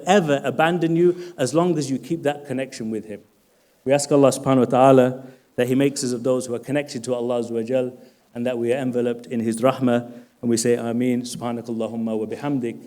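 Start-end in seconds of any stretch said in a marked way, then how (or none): 3.8-12.8 low, soft supplication; closing prayer. Real, not acted. Speaker asks Allah's mercy for the congregation, low prayerful voice. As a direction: recite a subdued closing dua, much lower.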